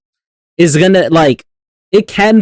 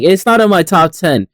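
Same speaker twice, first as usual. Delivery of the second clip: ordinary delivery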